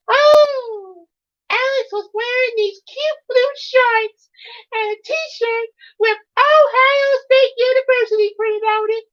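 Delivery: very high, squeaky puppet voice; high Elmo voice all through. Pretend. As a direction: chirp it like a cheerful Muppet. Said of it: "I know I could get Elmo".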